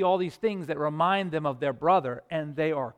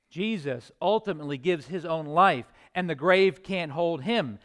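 louder, driving proclamation; triumphant preaching; 2 semitones higher, 6 dB louder than usual. Acted. Preacher proclaiming resurrection to the congregation, triumphant full-force preaching. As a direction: proclaim the victory with conviction. Sermon climax.

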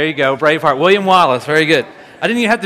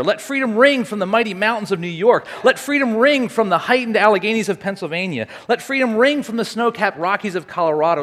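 higher-pitched, rolling oratorical refrain; dramatic speech reading; 5 semitones higher than usual. Acted. Speaker resuming the I Have a Dream speech, stirring oratory. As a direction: ring out each repeated line like a rally speech.

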